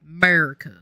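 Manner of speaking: loud, hammed-up American "America"; heavy American accent on "America"; exaggerated American accent. Shown as a demonstration. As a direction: belt "America" like a mock patriot, in American accent. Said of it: "I love saying".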